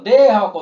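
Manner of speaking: loud, long "দে" before "হক"; drawn-out syllable. Shown as a demonstration. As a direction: hold "দে" for a double beat. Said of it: "দে দুই মাত্রা".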